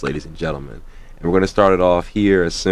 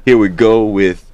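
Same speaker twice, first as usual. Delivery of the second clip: louder, punchy song intro with station ident; radio announcer; 3 semitones higher, 4 dB louder than usual. Real, not acted. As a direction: kick the track off for the listeners.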